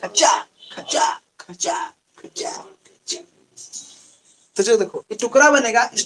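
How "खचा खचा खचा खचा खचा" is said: whispered, slow scissor noise; scissors snip. Shown as a demonstration. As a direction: mimic scissors snipping with breath. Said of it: "ऐसे कैंची लाए".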